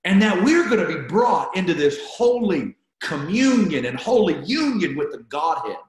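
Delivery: ordinary talk, nothing marked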